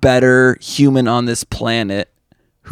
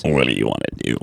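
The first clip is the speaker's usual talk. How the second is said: much lower, flat machine voice; deep robot voice; 14 semitones lower, 7 dB quieter, much faster than usual. Pretend. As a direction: drone it like a phone robot. Imitating the phone robot.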